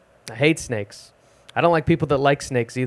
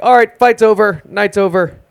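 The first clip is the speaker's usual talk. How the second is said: loud, slow bouncer breaking it up; 5 semitones higher, 5 dB louder, slower than usual. Retold or imitated. Bouncer shutting down a shirtless bar fight, loud and firm. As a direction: announce the fight is done like a bouncer.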